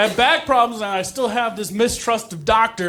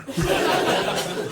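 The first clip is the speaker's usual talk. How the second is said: crowd laughter; 4 dB quieter than usual. Real, not acted. Audience laughs at joke.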